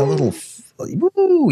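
high falsetto woo, laughing along; excited whoop. Real, not acted. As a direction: break off into a giddy whoop. Joins the whoop mid-sentence.

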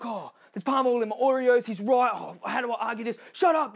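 acted frustration. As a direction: act out an exasperated activist losing their cool. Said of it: "you're gonna get frustrated with that".

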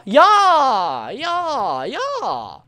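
cheering. Real, not acted. Cheering repeatedly.

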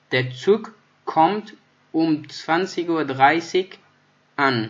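slow dictation. Shown as a demonstration. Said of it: "teacher models sentence word by word".

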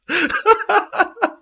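breathless joyous laugh; elated laughing; high giddy laugh. Real, not acted. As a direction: squeal with laughter. Celebrating win.

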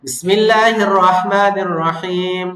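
slow, melodic tajweed chant; slow chanted recitation; Quran recitation. Shown as a demonstration. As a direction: recite slowly for students to follow. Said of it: "শুরু থেকে আমার সাথে বলুন".

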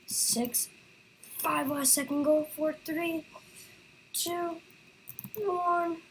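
counting down, rising, on edge; tense countdown. Real, not acted. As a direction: pitch climbing with each number. Last-second countdown, rising tension.

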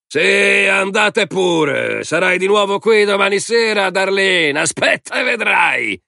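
high, drawn-out taunting shout; jeering call. A narrator's reading. Man shouts after departing woman, jeering.

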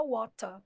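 WATER with a crisp T and no final R; British pronunciation. Shown as a demonstration. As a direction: say water the British way, "waw-tah". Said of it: "no inglês britânico".

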